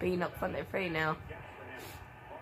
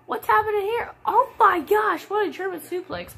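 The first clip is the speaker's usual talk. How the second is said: high, loud burst of excitement; excited outburst; 13 semitones higher, 6 dB louder than usual. Real, not acted. Commentator watching the wrestling match, high excited outburst. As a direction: jump up at the big move.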